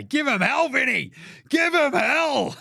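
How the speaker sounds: high, loud rallying yell; cheering shout. Real, not acted. Cheering France's coach on, high loud yell. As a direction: yell it like a fan in the stands.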